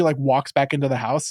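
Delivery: ordinary talk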